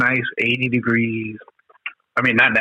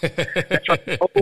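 slow, laughing through the words; 4 semitones higher, 4 dB quieter than usual. Real, not acted. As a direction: chuckle as you say it. Laughs remembering the pole.

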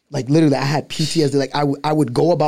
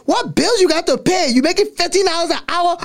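imitating his mom. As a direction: squeak it out like a mother roasting her son. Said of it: "my mom's like".

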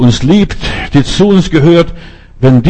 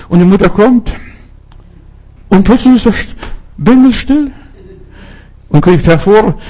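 rising excitement; 7 semitones higher than usual. Real, not acted. Climax of the lost-child story.

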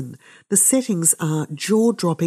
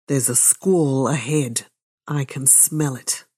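ordinary delivery

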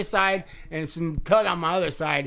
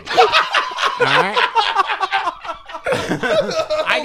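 laughing and half-shouting through the words; laughing hard; 6 dB louder, much slower than usual. Real, not acted. Brother laughs at his own bike crash, loud laughter. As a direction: crack up telling the worst part of the crash.

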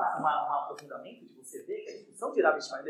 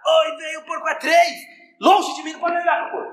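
raised, high-pitched mock alarm at the pig; alarmed exclamation; 9 semitones higher, 7 dB louder than usual. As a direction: recoil in loud pretend horror, "far be it from me".

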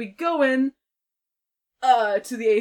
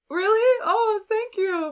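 excited thanks; 13 semitones higher, 6 dB louder than usual. Retold or imitated. Thrilled at buffet invite.

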